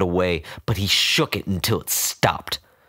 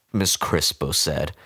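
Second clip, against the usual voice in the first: plain reading voice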